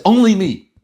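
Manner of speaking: a mock selfish shout; loud selfish soul. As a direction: demand it like a greedy soul. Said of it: "Nefesh Elokis says".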